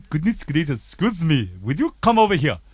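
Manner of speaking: put-on Indian accent. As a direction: imitate an Indian-accented customs officer. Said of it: "What accent is that?".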